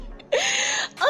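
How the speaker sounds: high-pitched giggle; light, high tittering laugh. Real, not acted. Host teasing her boo-boo on air, high giggle.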